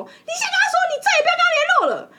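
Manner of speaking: 又高又响地模仿女生大吼命令，语速很快; 尖着嗓子喊出来，像在逼人表态; mock shouted order. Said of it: "然后说". (笑着高声模仿女友大吼下令)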